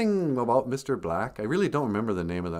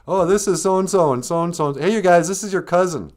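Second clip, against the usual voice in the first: loud, higher rattled-off introductions; higher, louder acted hospitality; relative making introductions; 8 semitones higher, 9 dB louder than usual. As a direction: call out to the family as a chatty relative. Voices relatives introducing cousins.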